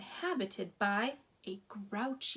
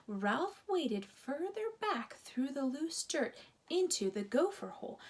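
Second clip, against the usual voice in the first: ordinary delivery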